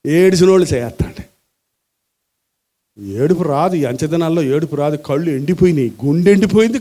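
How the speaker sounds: ordinary delivery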